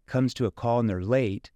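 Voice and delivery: normal talking voice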